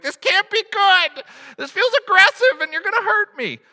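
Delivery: hammed-up fear of being cracked in half; mock panic. Patient pinned by a rough chiropractor, mock panicked.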